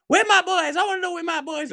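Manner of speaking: very high, put-on mother voice; Catherine voice. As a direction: wail for your boys in falsetto. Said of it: "this is my Catherine voice".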